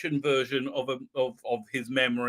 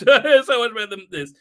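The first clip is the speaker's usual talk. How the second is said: louder repeat, laughing as you say it; laughing emphasis; 6 semitones higher, 9 dB louder than usual. Real, not acted.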